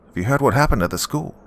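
nosy neighbour, mildly delighted by bad news; almost happy. A narrator's reading. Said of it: "He sounded almost happy about it".

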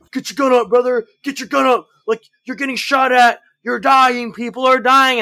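high, loud hammed-up urgency; mock panicked yelling. Imitating range dudes trying to induce stress, frantic mock yelling. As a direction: yell it like a range guy faking a firefight. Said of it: "So the dudes will be like".

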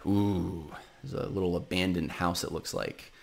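spooky hush. Pretend. Spooky house narration, voice drops.

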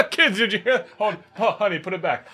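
delighted dad turning to his wife; dad chuckling at his own pun to the family; corny dad. Retold or imitated. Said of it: "what some dad would say to his wife".

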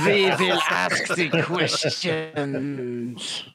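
very slow, drawn-out mock German; German accent. Playing a German interrogator in a very slow German accent. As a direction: stretch every word in a hammed-up German accent.

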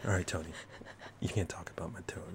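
imitating her, soft and fast; friend's retold warning; mock whisper. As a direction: hush it like a secret. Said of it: "you're like".

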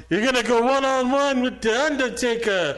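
high, loud GM announcement; Teddy Long impression. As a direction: boom it like a hype general manager. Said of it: "Teddy Long was funny".

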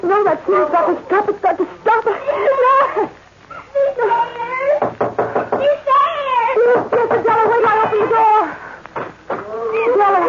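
high, loud, frantic cries of terror; losing control in rising panic; terrified panic. Acted. Jane hearing the caged beast, crying out in terror.

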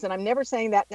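usual speaking voice

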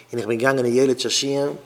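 ordinary talk, nothing marked

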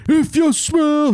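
high, stretched-out catchphrase; wrestling catchphrase. Retold or imitated. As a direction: belt it slowly like an arena entrance. Imitates The Rock's catchphrase.

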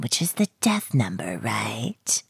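hushed ominous whisper; spooky whisper. Acted. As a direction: whisper it like a ghost story. Spooky hint about death number.

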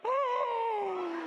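high, loud shocked Oh with a laugh; shocked shout. Retold or imitated. Husband seeing wife fall, high loud shocked shout. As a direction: explode in disbelief. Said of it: "And I'm like".

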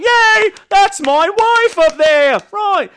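high, loud cheer in a put-on British accent. Cheering for his wife on stage in British accent. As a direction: gush like a proud British husband in the audience. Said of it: "why I said it like that".

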